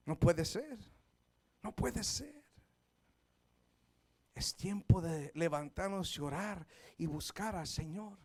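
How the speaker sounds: low, soft storytelling; hushed preaching. Acted. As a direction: draw them in, barely above a murmur. Soft narrated sermon. (predicación suave y baja)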